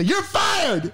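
high shouted you're fired; crazed boss firing the employee with a high shout; yelled firing. Pretend. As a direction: bellow it at him. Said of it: "and I say".

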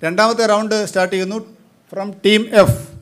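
loud, quick game-show announcement; quiz announcement. Acted. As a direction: boom it out like a quizmaster opening the round. Quizmaster formally announces round two.

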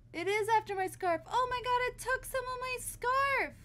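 high, rising panic; frantic, high-pitched alarm; panicked outcry. Real, not acted. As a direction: squeal in alarmed dismay. Creature steals her scarf.

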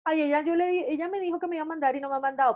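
high and fast, mimicking a complaining seller; mock whiny seller. Director mimics a seller whining about an unsent order, high and fast. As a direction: play the seller who whines she got no order.

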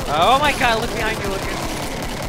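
high, loud panicked warning; panicked shout. Real, not acted. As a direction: yell the warning in alarm. Warning friend of monster behind.

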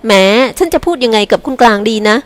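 ordinary delivery, nothing marked

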